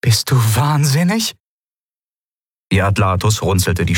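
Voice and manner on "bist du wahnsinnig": incredulous anger. A narrator's reading. Ruler confronts her aide.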